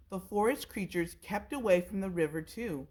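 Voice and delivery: ordinary delivery, nothing marked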